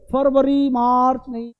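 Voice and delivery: ordinary delivery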